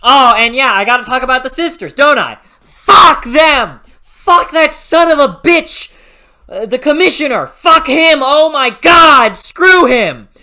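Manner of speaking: loud, high screaming rant at the commissioner; furious rant. Real, not acted. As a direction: tear into him at full volume. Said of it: "I'm still pissed about this".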